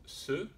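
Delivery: « se » isolé avec un s sourd, plus aigu; son se. Shown as a demonstration. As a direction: articuler « se » seul, sifflante non voisée. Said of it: "on a bien le son". (le son « se » isolé, s sourd)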